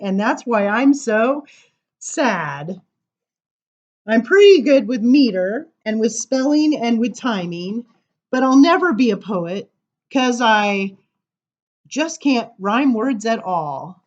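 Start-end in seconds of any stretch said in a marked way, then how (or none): none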